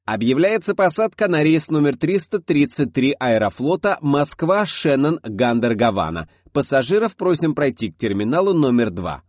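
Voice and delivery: high, loud announcement voice; airport announcer. A narrator's reading. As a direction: boom it across the terminal. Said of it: "чувственный женский голос разнёсся по гулкому залу".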